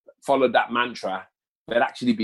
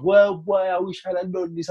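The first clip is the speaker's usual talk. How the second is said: mock regretful older self; 7 semitones higher than usual. Imagined regretful future self.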